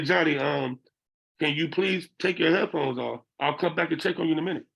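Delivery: normal talking voice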